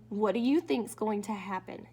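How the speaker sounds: ordinary delivery, nothing marked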